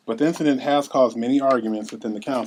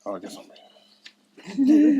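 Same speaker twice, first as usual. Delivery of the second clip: put-on child's voice; 13 semitones higher, 4 dB louder, much slower than usual. Young [name] training with dad.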